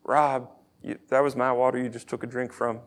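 soft, low, sheepish grumble; mild complaint. Pretend. Pastor mimicking a petty water complaint, mild and low. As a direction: gently point out a small annoyance, low. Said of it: "It's not like".

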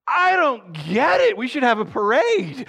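high, slow mock outburst; exasperated bafflement. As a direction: protest in hammed-up disbelief. Baffled by the mourners, acted.

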